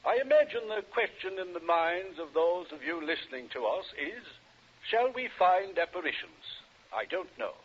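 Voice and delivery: ordinary talk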